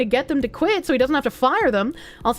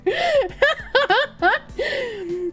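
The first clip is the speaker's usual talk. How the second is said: high laugh; 12 semitones higher than usual. Real, not acted. Laughs at own accent slip.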